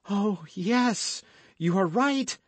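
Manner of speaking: high-pitched frightened agreement; affrighted cry. A narrator's reading. Frightened woman agrees with the guardsman about the danger, affrighted cry. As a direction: agree in alarm, pitch well up. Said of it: "cried the affrighted Madame Bonacieux".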